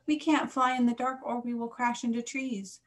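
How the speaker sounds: lightly voiced anxious young bat; worried youngster. A narrator's reading. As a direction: protest in a small high voice. Young bat protests to mother.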